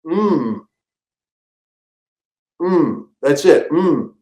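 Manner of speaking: soft slow yum hums; mock tasting hum. For the Chipwich commercial director, soft slow tasting hums. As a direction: hum over the bite as if delicious. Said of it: "They want me to go".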